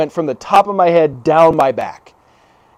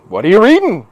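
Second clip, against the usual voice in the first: loud, higher, too-eager question; flustered blurting; 4 semitones higher, 5 dB louder than usual. Retold or imitated. Nineteen-year-old to a woman he just woke, flustered blurting. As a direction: blurt it out in awkward panic. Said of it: "I didn't know what to do".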